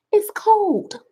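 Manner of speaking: high, breathless whispered whine; whiny whisper. Acted. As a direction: whimper it like a child complaining. Whiny excuse about the door.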